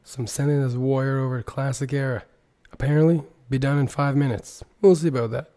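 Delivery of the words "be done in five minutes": usual speaking voice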